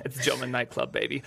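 badly faked German nightclub accent. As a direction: joke in a put-on German accent. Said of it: "Is that a German accent?".

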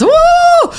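high loud woo; cheering whoop. Retold or imitated. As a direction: let out a cheer. Retold team cheering.

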